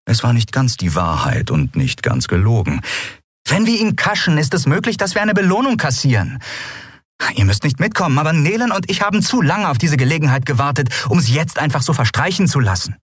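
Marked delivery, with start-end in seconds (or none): 3.5-13.0 much higher-pitched, insistent voice; determined Endriel. Acted. Endriel tells her crew of the plan, determined. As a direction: make the case insistently in a high voice.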